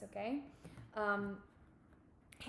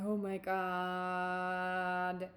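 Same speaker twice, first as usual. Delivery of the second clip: very slow, drawn-out mock groan; heavy, confused groan; 3 semitones lower, much slower than usual. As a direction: drag it out like you're drowning in confusion. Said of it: "you going".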